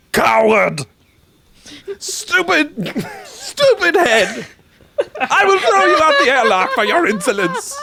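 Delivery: angry shout. Acted. Twin threatens the other twin with the airlock, angry shout. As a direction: rage at the twin.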